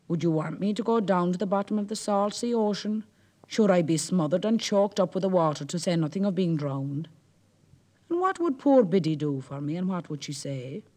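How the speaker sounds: worried Irish fisherman protesting, mildly alarmed; lightly voiced anxious Jack. Jack protests to the Merrow, lightly fretful. Jack frets about going under.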